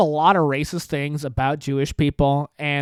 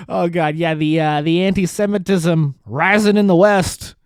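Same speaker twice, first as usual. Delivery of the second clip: ordinary talk, nothing marked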